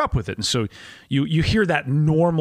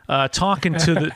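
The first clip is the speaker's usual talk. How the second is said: normal talking voice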